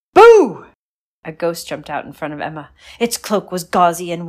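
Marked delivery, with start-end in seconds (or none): 0.1-0.7 high loud spooky boo; ghostly boo. Acted. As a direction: jump out and boo. Ghost jumps out.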